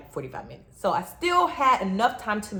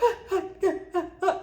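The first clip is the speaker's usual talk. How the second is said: choked laugh-sob; 10 semitones higher than usual. Retold or imitated. Looking at her, can't talk.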